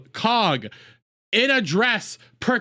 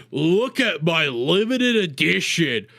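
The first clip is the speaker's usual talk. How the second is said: a lower, slow show-off voice; mock bragging bro; 6 semitones lower than usual. Streamer mocking flexing by playing a smug show-off, lower and slower. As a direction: flaunt the figure like a smug rich kid. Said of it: "You don't see me going around going like".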